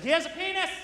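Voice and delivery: put-on high, accusing little girl announcing "has"; accusatory little girl. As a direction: point and accuse like a toddler. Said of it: "Like a very accusatory way".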